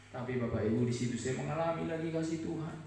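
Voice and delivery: normal talking voice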